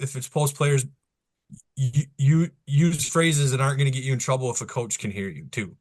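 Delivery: ordinary delivery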